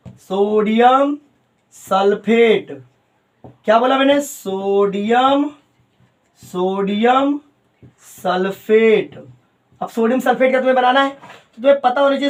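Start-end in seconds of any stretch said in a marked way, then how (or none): 0.1-2.8 sodium drawn out and rising, pause, sulphate drawn out and falling; slow dictation. Real, not acted. Dictating the name for note-taking. 4.2-9.2 very slow repeats, ending on a long falling sulphate; slow dictation. Real, not acted.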